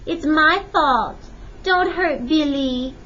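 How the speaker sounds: put-on slow, high-pitched child's plea; pleading cry. A narrator's reading. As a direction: plead with mother for a friend. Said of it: "Lupe cried".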